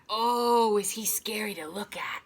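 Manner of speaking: breathy ghost-story whisper; spooky whisper. A narrator's reading. Reggie scaring Ira with his ghost story, whispered. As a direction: draw the listener in with a whisper.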